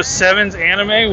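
ordinary talk

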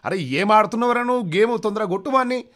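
very high, loud ringside shouting; yelling fan. Acted. Dentist cheering at a boxing match, yelling very high and loud. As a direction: scream at the boxer to hit harder.

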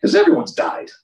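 playful high quip; joking high. Real, not acted. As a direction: squeak out the joke. Joking aside.

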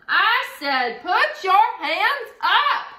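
high, loud, slow roar. A narrator's reading. Jemima roaring at the bandits to put their hands up. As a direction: roar it so the room goes silent. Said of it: "Jemima roared".

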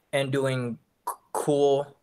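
real stutter on the c; repeating the first sound. Real, not acted. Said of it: "I have a stutter".

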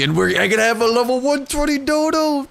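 rising high and loud in delight; excited. Real, not acted. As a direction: burst out with real thrill at the find. Finds highest level Dodo ever.

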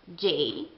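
J said as jay; English J. Shown as a demonstration. Teacher showing English J. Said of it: "si pronuncia con J".

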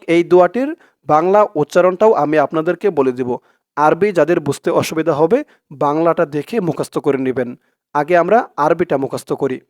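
ordinary talk, nothing marked

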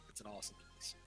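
usual speaking voice

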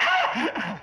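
mock startled yelp. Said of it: "I was like".

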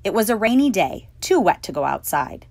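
ordinary delivery, nothing marked

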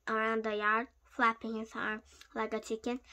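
plain reading voice

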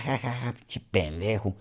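slow, soft taunting laugh; mocking laugh. Mocking officer laughing at the father, soft and slow. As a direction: sneer the laugh out slowly. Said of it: "se empezó a burlar hasta de mí".

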